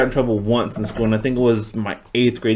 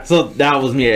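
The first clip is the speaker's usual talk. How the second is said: ordinary talk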